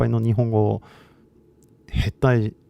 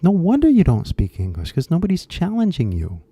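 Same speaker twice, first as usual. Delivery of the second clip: higher, exasperated outburst; indignant realization, pitched up; exasperated reaction; 7 semitones higher than usual. Retold or imitated. Teacher reacting to how students are taught, exasperated.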